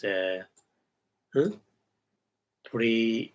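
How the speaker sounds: ordinary delivery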